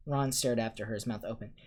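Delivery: ordinary delivery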